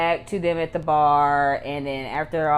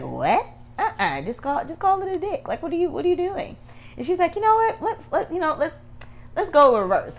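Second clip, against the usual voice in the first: much higher mock voice of a grossed-out woman; Ella's shocked voice; 12 semitones higher than usual. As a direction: play her squeamish and bossy, pitched way up. Voicing Ella rejecting the bartender.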